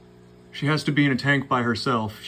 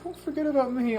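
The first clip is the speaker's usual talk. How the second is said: much higher squeaky little fish voice; voicing the fish. Owner voicing her fish begging not to be forgotten, high and squeaky. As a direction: give the pet a needy tiny voice. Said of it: "She's like".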